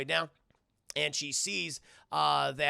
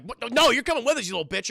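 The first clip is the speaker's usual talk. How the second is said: indignant yell; 6 semitones higher, 8 dB louder, faster than usual. Acted. Tulip yelling at the cat.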